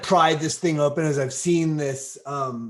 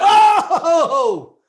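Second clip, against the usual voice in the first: excited shout; 14 semitones higher, 10 dB louder than usual. Real, not acted. Excited outburst at table.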